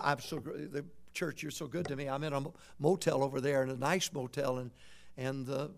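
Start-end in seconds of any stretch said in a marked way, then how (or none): none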